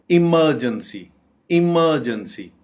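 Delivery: "इमरजेंसी" said i-MUR-jun-see, r silent; correct pronunciation. Shown as a demonstration. Teacher modelling the word, "इमरजेंसी" as i-MUR-jun-see. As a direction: open "इमरजेंसी" with i and lean on MUR. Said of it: "बहुत गलत बोला जाता है".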